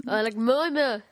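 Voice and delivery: mooing in a mock Jamaican accent; Jamaican cow. Podcast host becoming a Jamaican cow, mock Jamaican moo. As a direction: slide from Jamaican patter into moos. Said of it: "say moo in Jamaican".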